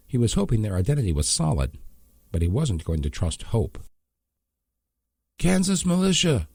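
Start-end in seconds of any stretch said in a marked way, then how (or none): 5.4-6.5 much higher, called-out yell; yelling ahead. Acted. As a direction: call it ahead to the troops. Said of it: "he yelled ahead".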